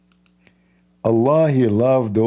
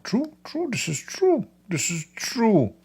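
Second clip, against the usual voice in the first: mock solemn agreement; 11 semitones higher, 4 dB quieter than usual. Speaker mocks the text by agreeing in high voice. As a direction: play the believer in a high thin voice.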